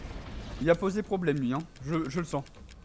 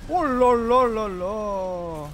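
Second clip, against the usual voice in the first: drawn-out oh la la; 7 semitones higher, 4 dB louder, much slower than usual. Real, not acted. Dodging the boss, dread.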